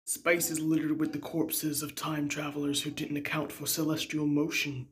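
low oracle voice; lightly voiced low guru; wise space avocado. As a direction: answer in a low voice like a sage.

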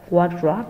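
WARDROBE with an o vowel up front. Shown as a demonstration. Teacher repeating the vowel for students, o sound in "Wardrobe". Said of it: "Cái chữ A này phát âm là o".

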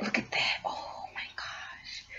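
awed breathy whisper; hushed in awe at the food. Real, not acted. Awed over spicy noodles.

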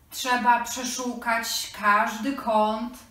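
ordinary talk

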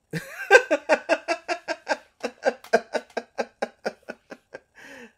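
a long, loud, high cackling laugh; big laughing fit. Real, not acted. Laughing at her own outburst, a long loud cackle. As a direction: let the laugh run away with you.

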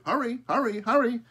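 high, loud squawky cry; crow calling. A narrator's reading. Crow herds the animals, high squawky call. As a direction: squawk them into the barn.

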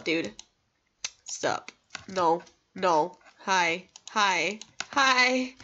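usual speaking voice

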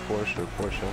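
Porsche as PORSH, then Porsche as POR-shuh; one syllable, then two on Porsche; two Porsche pronunciations. Shown as a demonstration. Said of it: "I vary".

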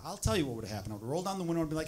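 ordinary talk, nothing marked

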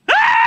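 very high, loud shriek; hammed-up terrified scream; cry of fear. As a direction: scream out in sudden fright. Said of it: "That's what it means to cry out in fear".

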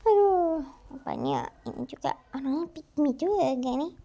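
ordinary talk

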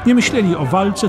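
usual speaking voice

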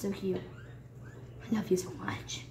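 whispered softly to her bunny; real tender whisper to a pet rabbit. Real, not acted. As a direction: breathe it to the rabbit like a secret.

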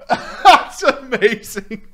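slow, words squeezed through laughter; laughing. Real, not acted. Still laughing at story.

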